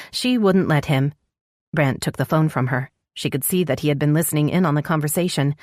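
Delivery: ordinary delivery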